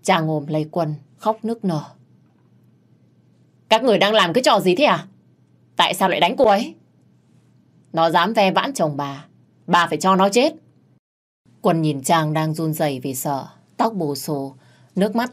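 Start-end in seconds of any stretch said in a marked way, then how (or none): 3.7-6.7 loud and fast, demanding answers; angry outcry. Acted. Quân confronting the attackers, loud angry demand. Quân finds Trang beaten. 7.9-10.5 vicious threat. Acted. Boss's wife defending the beating, loud vicious threat.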